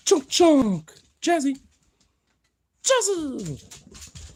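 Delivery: very high squeal to the dog; excited pet talk. Real, not acted. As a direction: squeal the pet's nickname. Calling excitedly to wiggling dog.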